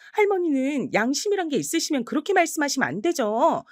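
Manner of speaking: high, indignant tirade; furious scolding. Acted. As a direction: let her have it, voice rising. Granddaughter scolds grandmother.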